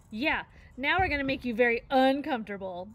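a cub parroting his sister; echoing threat. A narrator's reading. Brother echoes threat.

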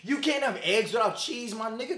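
usual speaking voice

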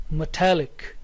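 "metallic" with the beat on "tal"; stress on -tallic. Shown as a demonstration. Teacher models the third word, stress on -tallic. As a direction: say "metallic" with a light "me" and weighted "tallic". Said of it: "primary stress is here on talic".

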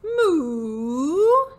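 loud, swooping cow moo; cow's moo. Acted. Storyteller voicing Cow catching out Turkey, drawn-out moo. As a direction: let out a long, loud, high moo. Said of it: "said Cow".

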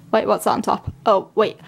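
normal talking voice

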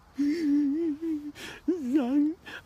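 long, high fake wail; hammed-up bawling; mock crying. Thug done with his life, mock crying. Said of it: "thug life to start crying".

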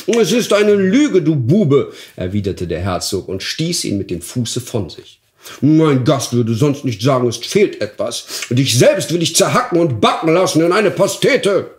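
furious duke. Acted. Duke kicks the dwarf away, raging. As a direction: roar at the liar.

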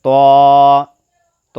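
ত rounded and long held, like "to"; thick heavy t. Shown as a demonstration. Teacher models the letter, thick drawn-out ত. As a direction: stretch ত heavily from the tongue tip. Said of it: "মোটাভাবে আদায় হবে".

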